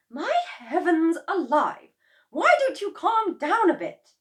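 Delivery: high, loud scolding; exasperated wife. A narrator's reading. Missus Cranky scolding her husband, high and loud. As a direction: tell him off. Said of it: "Missus Cranky said".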